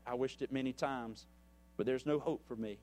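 ordinary talk

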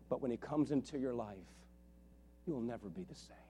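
hushed, heartfelt sermon close; soft tender preaching. Real, not acted.

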